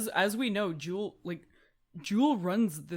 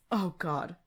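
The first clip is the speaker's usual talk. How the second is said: ordinary delivery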